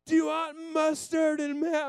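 put-on high, slow, loud child's voice; little daughter. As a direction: ask like a careful little helper. Daughter asking about sandwich.

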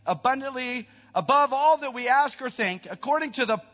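high, loud, ringing recitation; fervent scripture proclamation. Acted. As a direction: build to a forceful peak on the verse.

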